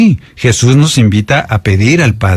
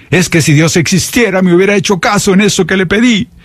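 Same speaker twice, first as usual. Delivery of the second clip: higher, put-on whiny grumble; resentful complaint; 8 semitones higher than usual. Preacher imitating a disappointed believer, higher whiny mock complaint. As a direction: voice a bitter doubter, lightly mocked.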